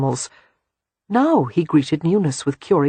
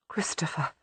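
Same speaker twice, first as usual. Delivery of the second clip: breathless shocked whisper; 14 dB quieter than usual. Acted. Sister whispers his name in shock after his news. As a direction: barely voice his name, stunned.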